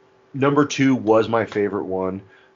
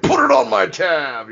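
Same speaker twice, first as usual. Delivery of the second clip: high, slow tough-guy voice, louder; slow put-on tough guy; acting tough; 12 semitones higher, 6 dB louder, slower than usual. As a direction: draw it out like a mock tough guy. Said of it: "acting all tough".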